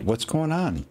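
sudden startled question; alarmed. Real, not acted. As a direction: caught off guard in the dark. Suddenly can't see in game.

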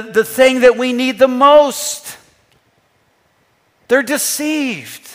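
usual speaking voice

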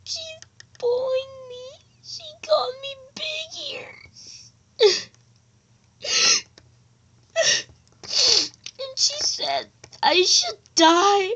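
a hint of a hurt kid; upset tattling. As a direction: tell on the bully near tears. Bullied child tells friends.

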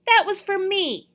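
loud, high, indignant complaint; high outraged yelp; outraged protest. A narrator's reading. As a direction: protest like a robbed kid. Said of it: "I yelled in outrage".

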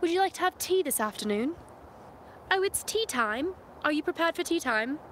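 regal, upright Queen's British; posh British accent. Shown as a demonstration. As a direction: speak the Queen's English, very posh. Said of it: "a more posh accent".

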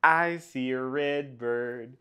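chanted refrain, leaping high and loud on the repeat; sing-song story reading. Acted. Reader to children with picture book, sing-song chant. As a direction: lilt it musically for little listeners.

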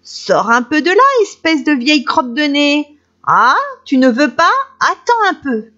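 high, loud mocking little girl; girl bullying the wolf in the bush; taunting girl. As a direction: taunt him like a bratty child.

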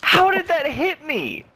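high-pitched whiny rant; raging gamer. Real, not acted. Complaining after death.

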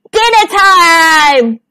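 slow, drawn-out loud cry; shout. A narrator's reading. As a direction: bellow it as a gleeful pounce. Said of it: "shouted Little Wolf and Smells together".

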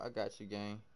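normal talking voice

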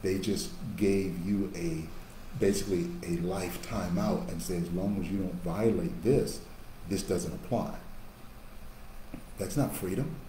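ordinary delivery

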